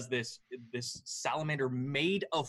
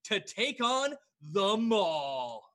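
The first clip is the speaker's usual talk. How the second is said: announcer voice; 7 semitones higher than usual. Acted. Announcer introduces next fighters.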